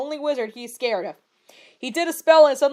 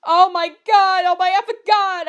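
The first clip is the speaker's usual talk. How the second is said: high, loud dismayed outburst; high, louder cry of dismay; cried out; 5 semitones higher, 5 dB louder than usual. A narrator's reading. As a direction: wail in dismay. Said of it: "I cried".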